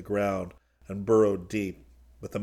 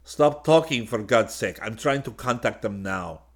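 louder snap easing into focus; sharp snap; 2 semitones higher, 5 dB louder than usual. A narrator's reading. Solo silencing Karyakin while working the radio, sharp. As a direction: cut them off, then press on.

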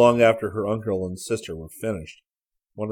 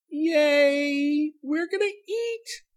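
very high, squeaky hushed cheer; gleeful; 4 dB louder, much slower than usual. A narrator's reading. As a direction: cheer in tiny high-pitched glee. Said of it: "silent gesture of glee".

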